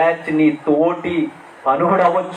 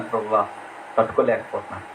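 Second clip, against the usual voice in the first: hushed plea; 7 semitones lower, 6 dB quieter, faster than usual. Retold or imitated. Voicing David's broken prayer.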